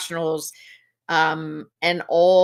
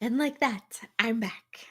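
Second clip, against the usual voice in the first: hushed, teasing comeback; playful whisper; 4 semitones higher, 7 dB quieter than usual. Acted. Host sneaking back after the break, whispered. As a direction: whisper it like a magic trick.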